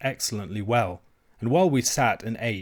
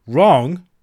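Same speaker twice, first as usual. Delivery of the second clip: loud, higher outburst of fury; furious; 5 semitones higher, 12 dB louder than usual. A narrator's reading. Alan turns on the maid in fury, furious. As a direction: fling it back in loud indignation. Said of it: "with a kind of fury".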